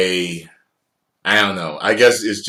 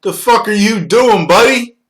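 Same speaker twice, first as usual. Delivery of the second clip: much higher, louder mock confrontation; mock angry local; 12 semitones higher, 8 dB louder than usual. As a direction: snap at a stranger calling him buddy. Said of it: "People will call you buddy".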